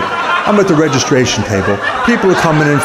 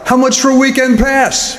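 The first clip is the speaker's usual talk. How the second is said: yelled out; 12 semitones higher than usual. Retold or imitated. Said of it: "I yell out".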